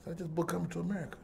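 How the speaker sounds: very soft, quick confession; subdued shock. Retold or imitated. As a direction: break the news under your breath, stunned. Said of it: "I was kinda shocked".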